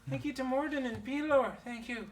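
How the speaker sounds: gushing thanks. Acted. GM voices grateful Darius.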